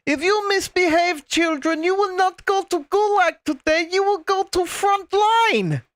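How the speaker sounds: high-pitched mock Russian officer threatening children; Russian military officer. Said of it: "she looked like a Russian military officer".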